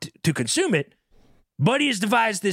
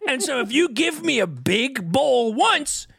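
ordinary talk, nothing marked

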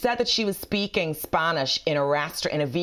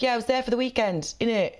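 higher put-on London voice; hammed-up London twang, pitched up; mock London accent; 5 semitones higher than usual. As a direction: imitate a mate's fake London accent. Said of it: "Were you in London recently?".

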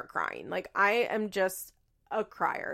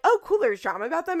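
quick, high, louder perk-up; mock excited; 8 semitones higher, 7 dB louder, faster than usual. Acting out her own reaction to promised drama, mock excited. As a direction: perk up fast at the gossip.

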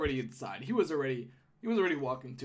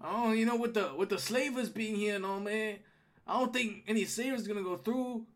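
higher-voiced gnome guard chatting; gatekeeper gnome; 7 semitones higher than usual.

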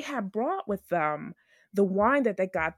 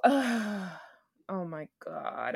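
exasperated sigh; 2 semitones lower, 4 dB quieter, much slower than usual. Real, not acted. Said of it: "I wanted to scream".

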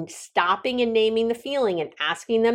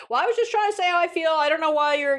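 quick, higher and defensive; defensive retort; 8 semitones higher, faster than usual. Retold or imitated. The other person pulling you off your formula, defensive higher retort. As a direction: brush it off in a rush, hurt and defensive.

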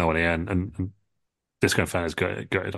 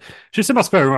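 pitched up high and a bit louder, defending himself; defensive squeak; 11 semitones higher, 7 dB louder than usual. Retold or imitated. Disco tells Triple H about the spare room, high defensive squeak. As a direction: blurt it out high like a caught kid.

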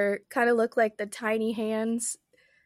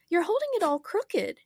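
crying out; 8 semitones higher than usual. A narrator's reading. Alice watches the Queen clutch at the brooch, crying out. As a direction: warn with mild alarm in a raised pitch. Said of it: "cried Alice".

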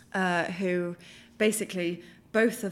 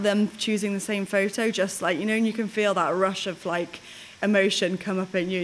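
ordinary talk

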